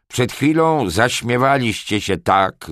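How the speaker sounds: loud, high thundering rebuke; grzmiący głos. A narrator's reading. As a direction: thunder at them like an angry lord. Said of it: "zagrzmiał Lorenz". (grzmiący, gniewny głos)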